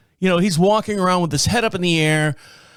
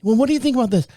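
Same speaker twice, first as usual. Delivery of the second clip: polite wife; 5 semitones higher, faster than usual. Retold or imitated. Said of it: "She's like".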